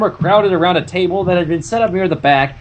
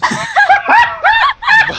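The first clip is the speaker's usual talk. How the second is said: high burst of laughter; loud laugh; 5 dB louder than usual. Real, not acted. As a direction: crack up loudly. Narrator cracks up at own joke.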